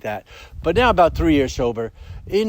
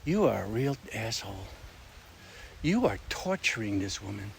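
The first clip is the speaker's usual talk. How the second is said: much softer, hushed inner voice scolding himself; inner self-scolding; 5 semitones lower, 12 dB quieter than usual. Retold or imitated. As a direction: murmur it like your own conscience. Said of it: "I'm like".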